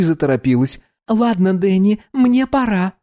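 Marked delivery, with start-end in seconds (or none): 1.1-2.9 put-on bright high young woman; high girl voice. Dominika voiced.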